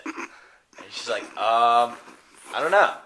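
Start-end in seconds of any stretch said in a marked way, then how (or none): 1.4-2.9 loud. Acted. Lady retold answering.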